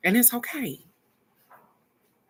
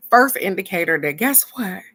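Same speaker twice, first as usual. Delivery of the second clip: ordinary talk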